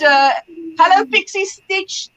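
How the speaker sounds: ordinary delivery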